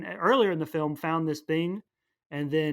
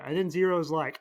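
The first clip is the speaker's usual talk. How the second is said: normal talking voice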